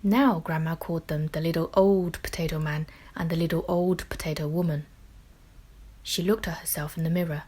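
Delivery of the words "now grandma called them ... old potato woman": ordinary delivery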